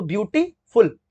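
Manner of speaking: Beautiful slowly split as beauty, then फुल; broken into syllables. Shown as a demonstration. Teacher showing students how to break it, beautiful said in slow parts. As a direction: leave a clear gap before फुल in beautiful. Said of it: "आप इसको break करोगे".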